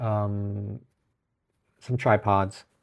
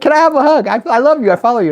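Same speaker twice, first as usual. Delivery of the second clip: much higher and louder, breathless asking for a hug; gushing fan; 12 dB louder, faster than usual. Retold or imitated. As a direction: gush like a starstruck fan. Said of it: "And I'm like".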